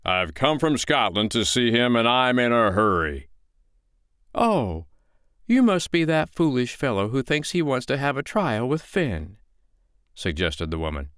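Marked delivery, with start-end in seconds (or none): 0.0-3.3 impatient storybook giant; gruff, heavy giant voice; growling giant. A narrator's reading. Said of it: "growled the giant". 4.3-9.4 high-pitched, sly woman's voice; storybook woman gently taunting; unruffled foster mother. Acted. Foster mother needles the giant.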